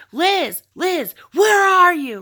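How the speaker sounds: high, loud, drawn-out calling of her name; frantic calling out. Retold or imitated. Boyfriend prowling the duplex calling for her, frantic high shout. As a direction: call for her like a man searching wildly.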